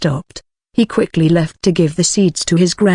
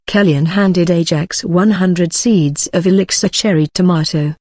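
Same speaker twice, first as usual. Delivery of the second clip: usual speaking voice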